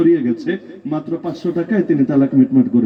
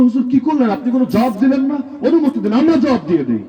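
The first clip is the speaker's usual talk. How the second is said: agitated disciples; 6 semitones higher than usual. Acted. Angry disciples urge their master.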